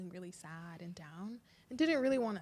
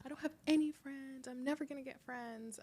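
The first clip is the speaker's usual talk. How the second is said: put-on tearful little boy; 6 semitones higher than usual. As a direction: voice the son's sad confession gently. Son crying about having no friends.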